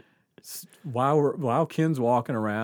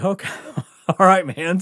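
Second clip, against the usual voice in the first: stifled laugh; 6 semitones higher, 9 dB louder than usual. Retold or imitated. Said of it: "We're like".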